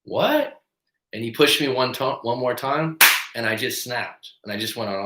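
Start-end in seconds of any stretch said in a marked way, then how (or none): 0.0-0.6 very high rising startled what; shocked, half a yelp; jolted what. Retold or imitated. Shoved in the schoolyard, jolted rising question. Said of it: "a jolt of".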